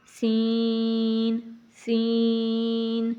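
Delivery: سین pushed into the nose, drawn out; wrong way, nasal سین stretched long; nasal sin. Shown as a demonstration. Teacher shows the wrong nasal سین. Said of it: "یہ سارا ناک کے اندر ہے۔".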